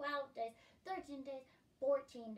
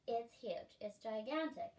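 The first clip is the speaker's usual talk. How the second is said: ordinary delivery, nothing marked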